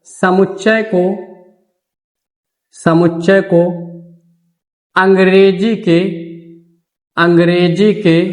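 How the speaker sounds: slow, word by word dictation with long pauses; teacher dictating notes slowly. Shown as a demonstration. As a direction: dictate each word so they can write it down. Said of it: "note point".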